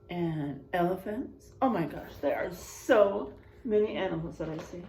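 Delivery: lively read-aloud, big swings on the rhymes; storybook reading. Acted. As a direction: read it to a class of kids with energy. Reading picture book aloud.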